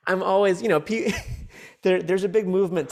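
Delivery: words broken by laughter; laughing. Real, not acted. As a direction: giggle through the words. Laughing while telling.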